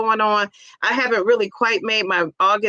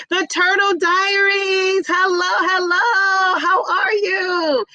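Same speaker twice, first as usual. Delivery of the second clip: high, slow, sing-song greeting; big high drawn-out hello to the chat; Turtle Diaries greeting voice; 8 semitones higher, much slower than usual. Pretend. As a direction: stretch it out like a kids' show host calling hello. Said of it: "I gotta channel me some Turtle Diaries".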